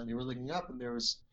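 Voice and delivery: ordinary delivery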